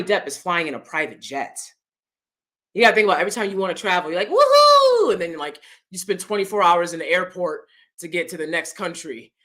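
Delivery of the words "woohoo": high, loud playful woohoo cheer; mock woohoo. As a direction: whoop it like a jet-setting celebrity. Mock celebrity cheering on travel.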